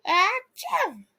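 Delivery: high, acted achoo; mock sneeze. A narrator's reading. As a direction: fake a sneeze. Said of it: "Chase sneezed".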